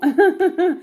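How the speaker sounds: quick, high giggle; amused laugh. Real, not acted. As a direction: laugh at your own bad accent. Laughs at own English accent. (petit rire aigu, amusé)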